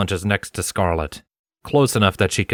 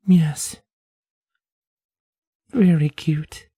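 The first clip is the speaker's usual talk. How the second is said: Scarlet's voice; 9 semitones higher, much slower than usual. A narrator's reading.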